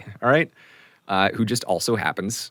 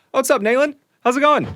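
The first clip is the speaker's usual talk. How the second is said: much higher, louder breezy hello; Chance's greeting; 8 dB louder than usual. Acted. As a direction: peek out and greet him brightly. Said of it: "Chance kinda peeks out from behind you guys and goes".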